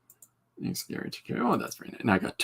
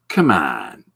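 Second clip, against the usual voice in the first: dismissive come on; 7 semitones lower, 6 dB louder than usual. Retold or imitated. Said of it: "he said it, like, four times".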